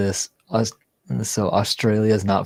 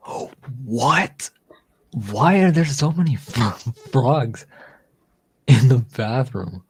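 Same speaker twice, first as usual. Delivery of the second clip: astonished; 3 semitones higher, 6 dB louder than usual. Real, not acted. Host seeing frogs all over a bathroom, astonished. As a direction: blurt it out, baffled and half laughing.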